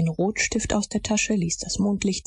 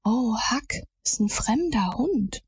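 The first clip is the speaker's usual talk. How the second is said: higher, wailing hushed dread; pitiful whisper; 5 semitones higher than usual. A narrator's reading. Tom after peering through the gap, pitiful frightened whisper. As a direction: moan it pitifully, voice swooping up. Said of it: "Der Ton seiner Stimme war erbarmungswürdig".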